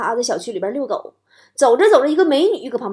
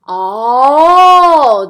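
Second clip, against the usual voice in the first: long, loud drawn-out "oh"; dawning realization; 11 dB louder than usual. Retold or imitated. Dad on the phone catching on, exaggerated realization. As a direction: swell the oh as the penny drops.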